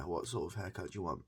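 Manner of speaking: ordinary talk, nothing marked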